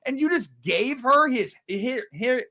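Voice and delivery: high-pitched, baffled protest; incredulous outrage. Real, not acted. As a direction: question it in rising disbelief. Outraged at the film's plot.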